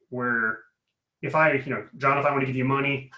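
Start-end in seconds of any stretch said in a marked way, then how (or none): none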